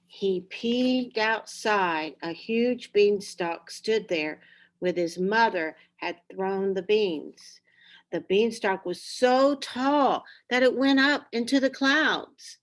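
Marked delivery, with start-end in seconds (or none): none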